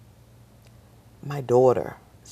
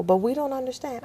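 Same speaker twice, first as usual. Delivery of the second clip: puzzled instructor; 14 semitones higher, faster than usual. Retold or imitated.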